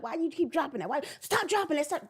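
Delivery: rising exasperated scold, jumping high on stop; mock scolding. Mother scolding her toddler, exasperated mock scold. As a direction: nag a toddler who keeps dropping things.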